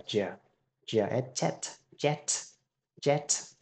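"jet" with a sharp j, the middle take breathed; modelling the j of "jet" for students; j sound. Shown as a demonstration. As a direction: hit the j of "jet" crisply. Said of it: "âm này là âm J".

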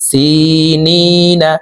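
সিনিনা very slow, নি held three alif; long stretch. Shown as a demonstration. Teacher modelling the three-alif stretch. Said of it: "নি এখানে তিন আলিফ টেনে".

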